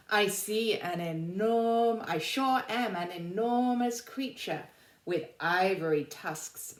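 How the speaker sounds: put-on reading poem to children. Teacher reading the elephant's poem to the class, slow and pleading. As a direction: read the elephant's rhyme like a storybook.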